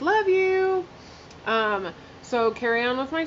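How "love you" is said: high, bright-eyed "love you" to friends; cheerful sign-off to the ladies; excited shout-out. Real, not acted. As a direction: call it out like blowing a kiss to friends.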